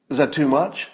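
normal talking voice

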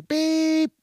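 high mouth beep like a car horn. Retold or imitated. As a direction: imitate a quick horn honk.

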